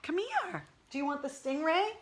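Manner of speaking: high, sing-song coaxing of a pet. Real, not acted. Owner calling her pug to come get the monkey toy, high coaxing baby talk. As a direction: coax the sleepy pug over with a toy.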